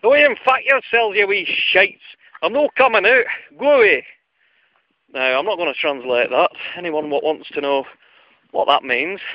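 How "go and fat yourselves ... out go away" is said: much higher, loud, rough Scottish yell; angry Scottish. Retold or imitated. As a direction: bellow in a grumpy Scottish accent to shoo the kids away. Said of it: "out came the strangest of responses".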